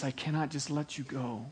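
soft, low, settled refusal; quiet refusal. Acted. Judge quietly refusing the pleading defendant again. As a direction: let the refusal drop to a hush.

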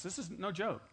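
ordinary delivery

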